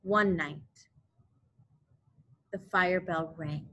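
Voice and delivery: ordinary delivery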